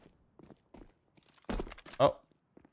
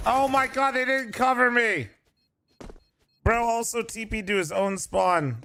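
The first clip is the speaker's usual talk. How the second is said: cover me shout; 8 semitones higher than usual. Real, not acted. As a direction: yell that they abandoned you. Outraged teammates left him.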